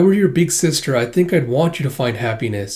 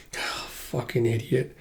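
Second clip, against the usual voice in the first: much softer, slow exasperated sigh-mutter; 2 semitones lower, 12 dB quieter, much slower than usual. Real, not acted. Viewer mutters at the hero in exasperation.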